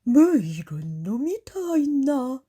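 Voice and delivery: lightly voiced baffled beast; puzzled tiger. A narrator's reading. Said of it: "갸우뚱 쳐다보는 호랑이". (어리둥절한 호랑이 목소리)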